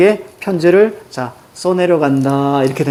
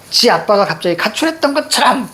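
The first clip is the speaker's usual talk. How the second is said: aggrieved complaint; 4 semitones higher than usual. Acted. Voices upset mother venting.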